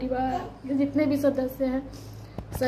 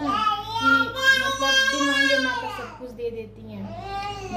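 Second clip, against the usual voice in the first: high, sing-song sob that cracks upward; crying out her faith in the goddess; voice breaking; 10 semitones higher, slower than usual. Real, not acted. Overcome while praising the goddess.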